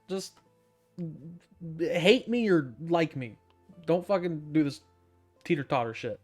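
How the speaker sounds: ordinary delivery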